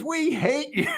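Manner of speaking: high-pitched mock crowd chant; imitating hostile crowd. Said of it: "two thirds of the crowd is".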